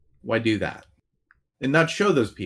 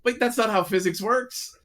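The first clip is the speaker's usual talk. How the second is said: put-on higher, childish playground taunt; playground kid; 8 semitones higher than usual. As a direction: play a kid bragging mid-game. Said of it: "two little kids on the playground going".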